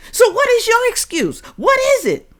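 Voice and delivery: very high, loud challenge; heated, high demand. Real, not acted. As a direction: demand the excuse. Demanding viewers' excuse.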